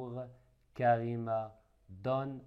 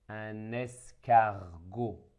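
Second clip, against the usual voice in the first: slow: un es-car-got, split into syllables; 5 dB louder than usual. Shown as a demonstration. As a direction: spell escargot out slowly in syllables. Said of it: "Après le découpage".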